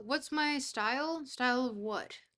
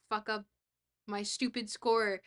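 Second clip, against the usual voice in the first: ordinary delivery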